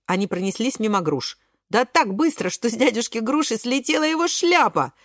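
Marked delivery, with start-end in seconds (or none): none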